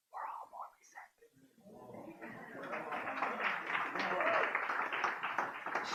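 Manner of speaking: secret-telling whisper. Acted. Pastor whispering a confession to the church.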